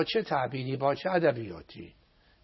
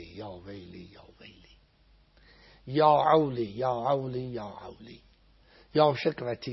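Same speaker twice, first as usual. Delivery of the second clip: slow chant, each repeated cry dying away to a whisper; fading prayer lament; slower than usual. Acted. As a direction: let every cry of woe fade out like a sigh.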